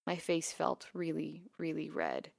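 plain reading voice

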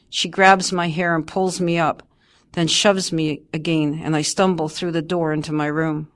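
plain reading voice

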